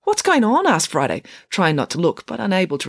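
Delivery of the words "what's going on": high, louder, alarmed question; shocked question. Acted. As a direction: blurt it out in shock. Friday shocked by Ian undressing.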